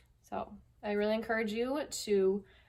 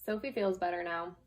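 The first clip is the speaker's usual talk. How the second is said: gentle, unhurried picture-book reading; lightly voiced storytime read; reading to children. Teacher reading a picture book to her class, storytime voice.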